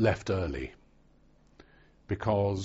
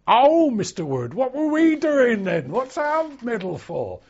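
high, loud sing-song sergeant voice; hammed-up sergeant teasing a recruit; mock drill sergeant; 9 dB louder than usual. Veteran imitating his flight sergeant mocking a recruit, high and loud.

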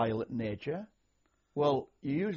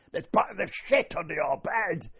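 higher, louder, slow barrack-room bark; bellowing corporal; 6 semitones higher, 5 dB louder than usual. Retold or imitated. Corporal jabbing his cane under the bed, yelling. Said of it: "he'd point his cane under your bed and say".

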